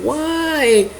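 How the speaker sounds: high, drawn-out imitated wail; mock-tearful high plea; retold crying wail. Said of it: "she was crying, and she kept going".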